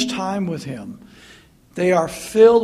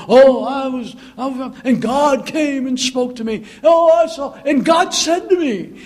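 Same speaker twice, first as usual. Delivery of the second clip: higher, louder gushing preacher voice; mock TV preacher; 5 semitones higher, 4 dB louder than usual. Mocking a TV preacher boasting of visions, hammed-up. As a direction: ham up a showy televangelist. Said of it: "these T V preachers".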